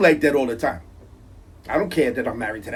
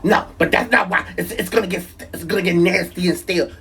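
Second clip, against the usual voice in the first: higher, insistent imitation of the wife; mock wife voice; 6 semitones higher than usual. Husband imitates wife defending her fridge rule, mocking high voice. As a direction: play the wife digging in on her point.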